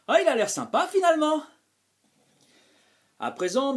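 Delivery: ordinary delivery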